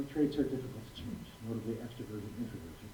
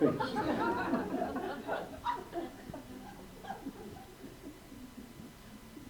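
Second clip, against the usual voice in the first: laugh; 12 semitones higher, 5 dB quieter than usual. Real, not acted. Laughing at kid's retort.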